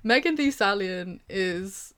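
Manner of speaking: ordinary talk, nothing marked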